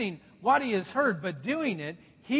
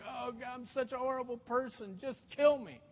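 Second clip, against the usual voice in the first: mock self-pity; 2 semitones higher, 7 dB quieter than usual. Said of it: "on the stake kind of thing".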